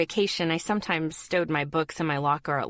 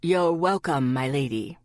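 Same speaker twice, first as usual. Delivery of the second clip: gallant gentleman's voice, dropping lower; 4 semitones lower than usual. A narrator's reading. Boy handing over tape to "my lady", gallantly. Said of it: "answered in his gallant voice".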